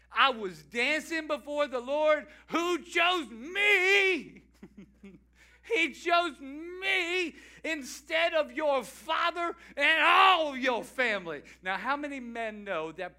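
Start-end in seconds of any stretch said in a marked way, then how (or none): 0.1-4.4 loud, slow retort; angry retort. Retold or imitated. David retorting to Michal, loud and slow. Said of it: "David retorted to Michal". 5.7-11.4 loud, slow retort; angry retort. Retold or imitated. David retorting to Michal, loud and slow. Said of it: "David retorted to Michal".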